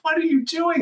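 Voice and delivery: very high, incredulous wife; retold alarm.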